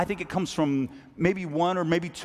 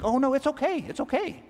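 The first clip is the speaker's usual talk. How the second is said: much higher, airy voice of a friend waving it off; gracious friend; 12 semitones higher than usual. Retold or imitated. The friend reassures you there's no return gift, high and airy. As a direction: play the gift-giver saying it's fine, lightly breezy.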